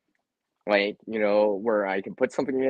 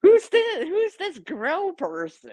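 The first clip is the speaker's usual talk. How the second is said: curious viewer voice; 4 dB louder, slower than usual. Pretend. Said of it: "they'll be like".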